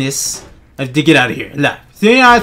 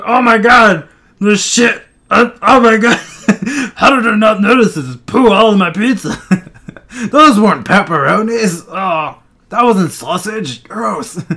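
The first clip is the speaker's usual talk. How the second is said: disgusted shock; 5 semitones higher than usual. Retold or imitated. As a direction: act horrified at the pizza. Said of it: "And you're like".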